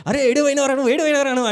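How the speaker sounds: a relieved, scolding mother shouting; worried scolding mother. Retold or imitated. Frantic mother finds lost child. (కంగారుగా గట్టిగా తిడుతున్న అమ్మ)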